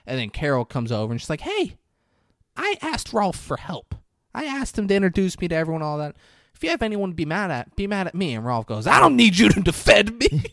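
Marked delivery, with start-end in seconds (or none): 1.4-8.4 mock woman's voice. Carol defending Rolf. 8.9-10.4 high, loud, hammed-up indignation; mock indignant shout. Rolf snapping at Carol.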